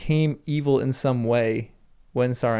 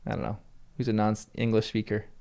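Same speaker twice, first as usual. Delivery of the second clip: normal talking voice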